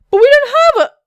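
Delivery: high, loud objection cut short; shocked protest. Acted. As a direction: protest loudly, then break off. Boss objects, then stops short.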